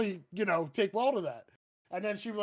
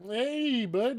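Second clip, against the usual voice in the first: put-on Canadian accent; 6 semitones higher than usual. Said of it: "Went from Canadian to Irish".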